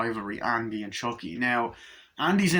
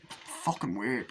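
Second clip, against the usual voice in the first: normal talking voice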